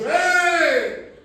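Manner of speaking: high, loud rebuking shout. Retold or imitated. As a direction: bark it out at the demons. God rebukes the demons.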